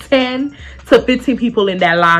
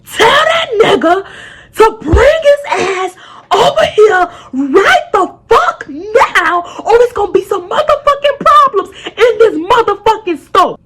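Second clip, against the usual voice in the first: put-on angry older man; 11 semitones higher than usual. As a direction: imitate a furious father yelling threats. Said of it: "The person who's screaming".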